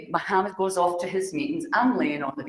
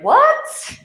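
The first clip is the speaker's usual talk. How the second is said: shrieking disbelief; 12 dB louder than usual. Retold or imitated. Told she's the guest of honor.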